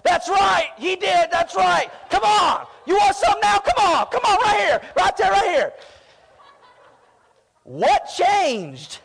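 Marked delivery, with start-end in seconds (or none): 0.0-5.7 high, loud schoolyard taunt shouted from behind. Retold or imitated. Kid hiding behind a big brother yells taunts at the bully. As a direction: egg the bully on, yelling, safe behind the big guy. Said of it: "I was, like, taunting him".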